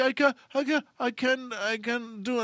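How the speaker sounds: high, panicked stammer; acted maid in a panic; flipping out. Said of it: "flips out".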